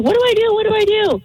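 panicked crying. Retold or imitated. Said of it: "and I start crying".

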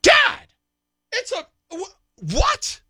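squeaky high, alarmed "Dad, what?"; shocked daughter. Retold or imitated. Dad imitates his daughter's shocked reply, in a squeaky high voice. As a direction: play a startled teen daughter on the phone.

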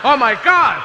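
high, loud mock shock; shocked cry. Kid shocked by a photo, high and loud. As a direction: gasp it out in horror.

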